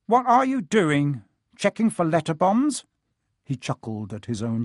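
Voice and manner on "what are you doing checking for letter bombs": mocking. Uncle Vernon mocking Harry.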